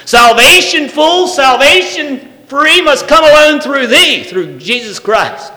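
loud, high declaration, each line hammered on is; preaching at full force. Acted. Preacher proclaims the one way to the congregation, loud and high. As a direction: thunder out that there is no other way.

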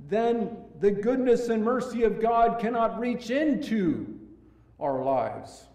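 loud, high, ringing pulpit voice; full-force preaching. Acted. Pastor warning the congregation, preaching loud and high. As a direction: drive the warning home from the pulpit.

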